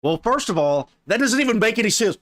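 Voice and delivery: high, loud, incredulous protest; exasperated outburst. Real, not acted. Reacting to the dating coach's example, exasperated.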